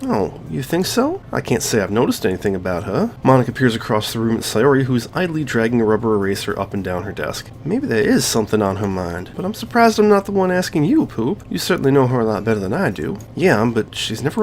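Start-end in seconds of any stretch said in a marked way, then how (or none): 0.0-1.2 higher, put-on girl's voice for Monika; playing Monika. Voicing Monika. 9.4-11.4 higher, put-on girl's voice for Monika; playing Monika. Voicing Monika.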